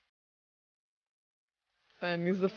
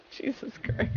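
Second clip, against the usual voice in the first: normal talking voice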